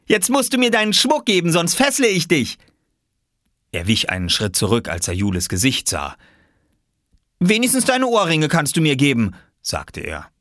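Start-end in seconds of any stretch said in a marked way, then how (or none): 0.1-2.6 put-on very high, louder boy's voice; high-pitched playful threat; child playing robber. Boy plays robber demanding loot. 7.4-9.3 put-on high, louder boy's voice; high-pitched childish demand; child bargaining. Boy bargains with girl.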